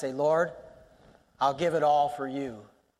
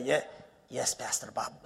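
quiet, lower Indian accent imitation; fond Indian accent impression of Mel; 4 semitones lower, 8 dB quieter than usual. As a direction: echo Mel's gentle Indian English answer. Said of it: "that little Indian accent".

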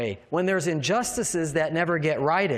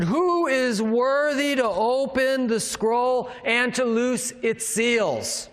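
high, drawn-out mighty proclamation; loud angel's proclamation; 5 semitones higher, slower than usual. Acted. Mighty angel asks who can open the scroll, loud proclamation. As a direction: call it out slowly, pitched up like a herald. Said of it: "with a loud voice".

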